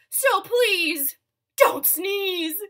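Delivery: high, loud, drawn-out plea; emphatic begging shout; loud pleading. Acted. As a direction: cry it out as a big appeal. Hippo begs elephant not to sneeze.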